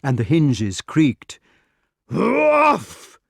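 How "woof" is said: very high, loud bark noise; explosive imitated "woof". A narrator's reading. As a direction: imitate a louder, higher crashing woof. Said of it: "cried the door for the third time".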